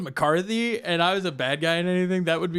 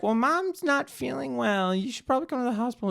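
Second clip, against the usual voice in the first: higher, put-on sister voice; worried sister; 9 semitones higher than usual. Sister telling her brother mom is ill, lightly voiced worried sister. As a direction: break the news gently. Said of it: "she's like".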